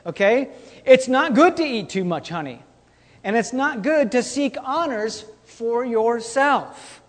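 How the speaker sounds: normal talking voice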